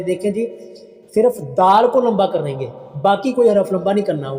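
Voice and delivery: usual speaking voice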